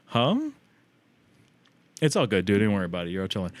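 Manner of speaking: normal talking voice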